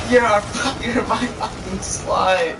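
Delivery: high, drawn-out teasing; mock insult. Vlogger re-enacting banter with girlfriend, teasing mock insult. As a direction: drag it out high with a laugh.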